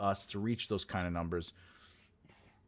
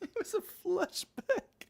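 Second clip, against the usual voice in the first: laughing; 5 dB quieter, slower than usual. Real, not acted. Laughs at own pun.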